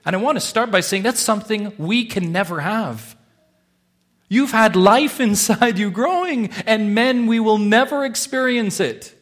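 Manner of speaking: loud, high, full of wonder; preacher marvelling to the women, loud and high; impassioned preaching. Acted. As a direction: proclaim it with awe. Preacher peaks on motherhood.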